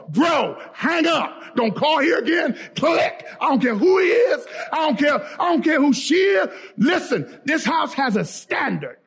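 high, worked-up telling-off; acted outrage, pitched high; indignant husband. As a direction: lay down the law to the caller. Acted husband ending the call.

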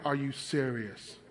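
low, soft and slow; deadpan disbelief. Retold or imitated. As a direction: let it drop quietly, stunned.

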